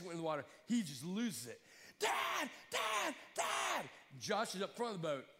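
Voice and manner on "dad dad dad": panicked scream. Retold or imitated. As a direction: yell for your father in terror. Son panics as dad jumps in.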